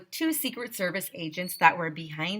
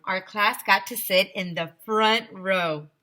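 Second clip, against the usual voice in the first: ordinary delivery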